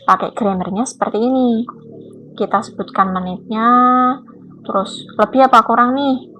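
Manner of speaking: ordinary talk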